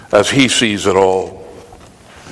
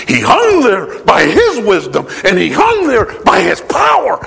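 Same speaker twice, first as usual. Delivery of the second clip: climbing higher to a shouted peak; rising preached climax; 9 semitones higher than usual. Acted. Preacher to congregation on the cross, rising to a shout. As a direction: build it up to a cry.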